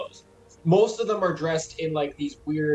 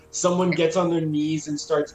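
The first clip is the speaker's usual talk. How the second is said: ordinary delivery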